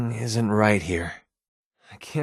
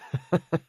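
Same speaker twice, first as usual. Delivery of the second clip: quick amused chuckle; a little burst of laughter; short laugh; 6 semitones higher than usual. Acted. Companion laughing at the player's joke, short chuckle.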